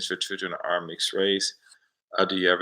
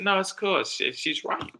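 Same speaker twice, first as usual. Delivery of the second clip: laughing reassurance; slower than usual. Retold or imitated. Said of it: "and I said".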